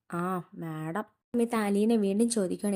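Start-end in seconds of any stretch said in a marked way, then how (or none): 0.1-1.1 low, soft, grudging mutter of the name; displeased. A narrator's reading. Grandmother grumbling about madam to her granddaughter, softly displeased. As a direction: let the dislike leak out under the breath. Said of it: "ഇഷ്ടക്കേട് കൊണ്ട് പറയുവാണ്".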